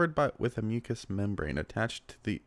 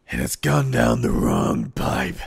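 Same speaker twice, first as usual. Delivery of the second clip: loud, slow, choked; choking; 7 dB louder, slower than usual. Real, not acted. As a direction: force it out, still coughing. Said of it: "I've swallowed coffee".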